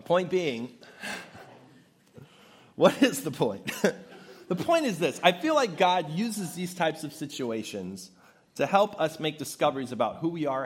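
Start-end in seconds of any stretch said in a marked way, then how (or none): 0.0-5.2 laughing. Real, not acted. Laughing after own story.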